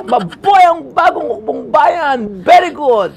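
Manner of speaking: high, loud, slow rallying shout; shouted slogan. Retold or imitated. Actor shouting his one line on set, loud and slow. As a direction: yell the slogan like a rebel soldier. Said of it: "Sinigaw ko".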